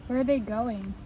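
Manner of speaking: plain reading voice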